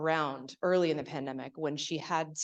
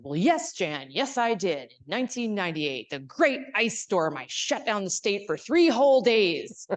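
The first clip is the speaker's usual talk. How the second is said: higher and louder, a hammed-up booming senator; Angus King impression; 6 semitones higher, 9 dB louder than usual. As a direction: play the proud old governor bragging, big and loud. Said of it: "And he said".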